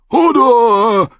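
high, loud crowing call; crowed Hodor. A narrator's reading. Hodor remembering his sword, high crowing call. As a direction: crow the name out happily. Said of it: "krähte er".

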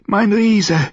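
high, loud ecstatic cry. Acted. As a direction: cry it out at the peak. Repeated cry during lovemaking.

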